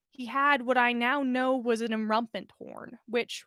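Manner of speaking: ordinary delivery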